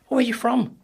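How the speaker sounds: loud, high-pitched mimicry of an intrigued stranger; put-on high, eager voice; curious local. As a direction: imitate an excited local spotting a foreigner. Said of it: "people wanted to".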